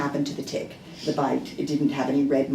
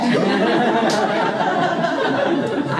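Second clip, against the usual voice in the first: embarrassed laugh; 8 semitones higher, 7 dB louder than usual. Real, not acted. Laughing at her own outburst.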